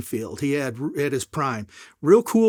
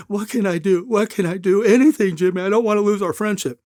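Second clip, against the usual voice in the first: begging friend; 5 semitones higher than usual. Retold or imitated. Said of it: "was begging me".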